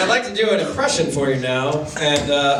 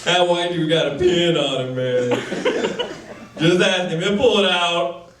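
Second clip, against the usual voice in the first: lightly voiced, slow, nervous open-mic comic doing a put-on voice; hesitant mock stand-up with long pauses; bad impression of a comedian.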